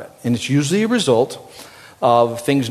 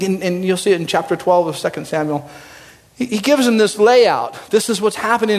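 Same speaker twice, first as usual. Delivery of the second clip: usual speaking voice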